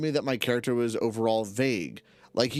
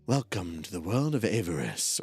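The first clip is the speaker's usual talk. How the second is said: sexy narration voice; 5 semitones lower than usual. Shown as a demonstration. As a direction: purr it like a seductive narrator. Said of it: "my sexy narration voice".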